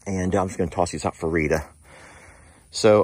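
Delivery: ordinary talk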